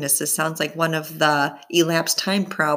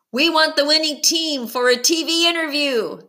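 calling out; 9 semitones higher, faster than usual. Acted. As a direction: shout over the noisy studio. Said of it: "someone else called out".

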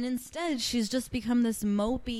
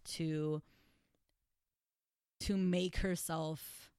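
ordinary talk, nothing marked

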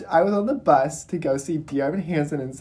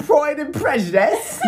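mock British accent; 8 semitones higher than usual. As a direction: pipe up in hammed-up British. Joining the British accent joke.

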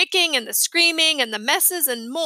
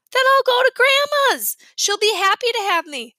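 much higher and louder, stubborn boast; defiant whine; 11 semitones higher, 5 dB louder than usual. A narrator's reading. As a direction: insist you have somewhere to go. Boy defiantly choosing Grandma's.

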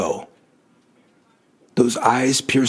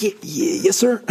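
stammered, high-pitched yes sir; anxious stuttering reply to the rock star; nervous stammer; 7 semitones higher than usual. Retold or imitated. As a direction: stumble out a timid yes sir. Nervous reply to admired Chris.

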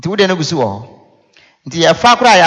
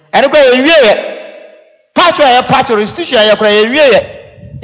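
slow, loud, higher preaching; fiery preaching; 7 semitones higher, 4 dB louder than usual. Acted. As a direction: hammer it home from the pulpit. Preaching at full force.

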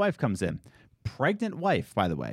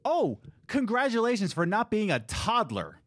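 higher, mock-cheery congratulations; sarcastic retort; 7 semitones higher than usual. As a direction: praise him with a sarcastic sneer. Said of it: "And I'm just like".

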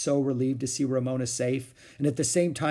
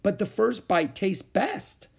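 higher, slow reasonable explanation; reasonable explaining; 9 semitones higher, slower than usual. A narrator's reading. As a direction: explain it patiently like it's obvious. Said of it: "explained Ramona reasonably".

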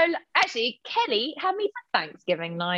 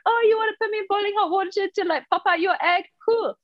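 put-on breezy cool-girl voice, higher and quick; cool chick voice; 8 semitones higher than usual. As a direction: lightly play a laid-back cool chick. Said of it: "cool chick who's like".

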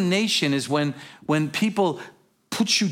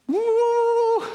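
high, long joyful woo; excited whoop. Acted. Preacher recalling when he first came to Jesus, excited whoop. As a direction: whoop like an exuberant new convert. Imitates his excitement as new believer.

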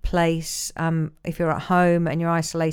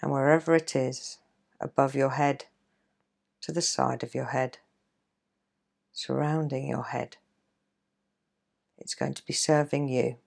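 guided meditation; 3 dB quieter than usual. Acted. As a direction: ease them into calm, gently. Leads a guided relaxation.

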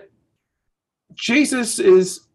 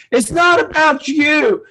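much higher, slow, pointed scolding of his son; stern dad rebuke; 9 semitones higher, slower than usual. Retold or imitated. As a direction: lecture the boy, slowly and pitched up. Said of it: "it's like".

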